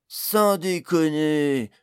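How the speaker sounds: loud, drawn-out, high protest; slow, loud acted objection. A narrator's reading. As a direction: stretch every syllable in an incredulous shout. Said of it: "protesta Jason".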